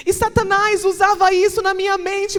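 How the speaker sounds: high, loud shout of a sermon peak; climactic preaching. Acted. Preacher testifying about Satan's lies, shouting. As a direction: hit the climax full force.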